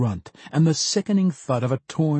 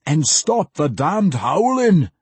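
loud, slow gruff demand; 5 semitones higher, 5 dB louder, slower than usual. A narrator's reading. The battle rager demands the mage stop howling, loud and slow. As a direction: order him to shut up. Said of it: "the battle rager demanded".

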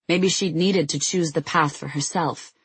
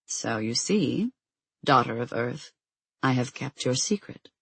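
prophetic trance voice; 3 semitones lower, 4 dB quieter than usual. A narrator's reading. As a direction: intone it like an ancient oracle. Oracle's voice, low and slow.

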